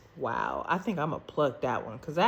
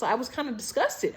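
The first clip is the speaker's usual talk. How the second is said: ordinary talk, nothing marked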